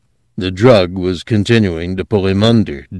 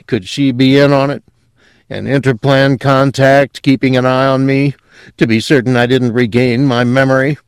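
high, louder character voice, wondering; drugged man growing suspicious, pitched up; suspicious Quayle; 6 semitones higher, 5 dB louder than usual. Acted. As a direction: puzzle it out in a raised voice.

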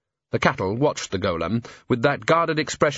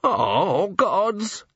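high, wailing cry; man hanging on, anguished; 8 semitones higher than usual. A narrator's reading. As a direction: wail it out. Repeated anguish.

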